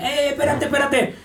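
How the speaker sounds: high, urgent mock call to the dog; stopping the dog. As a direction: call the dog off mid-act, pitch up. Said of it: "como agarrarlo y decir".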